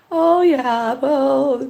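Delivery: high, put-on polite voice; forced niceness. Woman trying not to be rude, falsely nice. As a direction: stall sweetly while hiding your annoyance. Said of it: "you're trying to be nice".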